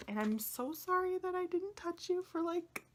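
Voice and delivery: very high, plaintive squeak; high-pitched, overwrought sorry; tearful apology. Real, not acted. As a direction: apologize to a beloved object, voice way up high. Tearful apology to her deck.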